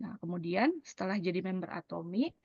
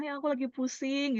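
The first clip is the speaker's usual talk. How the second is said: higher, put-on voice of a tired friend; acted friend with headache; 6 semitones higher than usual. Friend complaining of a headache, in a put-on voice. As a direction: play the friend who has a migraine.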